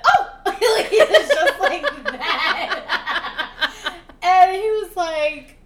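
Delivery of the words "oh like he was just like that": mock startled yelp. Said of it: "then he said".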